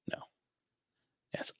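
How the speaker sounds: ordinary talk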